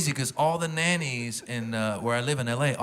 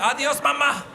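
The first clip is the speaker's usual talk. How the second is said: put-on Guatemalan accent; 8 semitones higher than usual. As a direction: shout it like a kid with a Guatemalan accent. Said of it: "he's got a Guatemalan accent".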